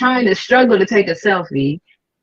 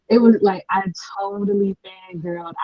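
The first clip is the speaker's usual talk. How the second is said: normal talking voice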